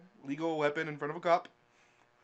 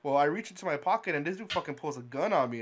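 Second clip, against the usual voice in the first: ordinary delivery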